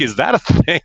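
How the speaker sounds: retold disbelief at odd news; incredulous. Puzzled reply to doctor's diagnosis.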